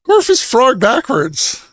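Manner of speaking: high, loud put-on shout; mock shout. Filling in the baffled co-host on the joke, hammed-up high shout. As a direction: shout it out high, hamming up the bit. Yelling the bit at confused Timmy.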